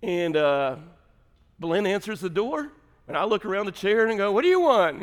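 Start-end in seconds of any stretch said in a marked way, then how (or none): 4.3-5.0 louder, higher mock irritation; retold annoyed challenge. Pastor retelling greeting at the door, mock annoyance. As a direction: call it out like a bothered off-duty pastor.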